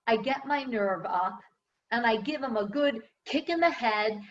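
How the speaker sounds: ordinary talk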